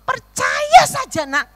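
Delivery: put-on high, drawn-out plea as God to a child; imitated divine urging, high and slow. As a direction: coax loudly, voice lifted, stretching the words. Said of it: "Tuhan berkata".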